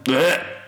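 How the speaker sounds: repeated short gagging heaves; imitated retching. Passed-out friend suddenly retching in car, mock gagging. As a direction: mimic someone about to throw up.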